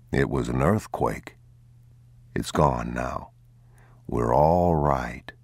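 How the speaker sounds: put-on steady and calm for the boy; father gently reassuring his frightened son. A narrator's reading.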